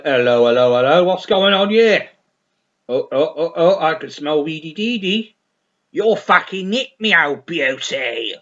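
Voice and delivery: loud mock Bristol policeman busting a gig; Bristol copper. Police raiding the gig over weed, loud mock Bristol accent. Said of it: "Oh no, it's in Bristol".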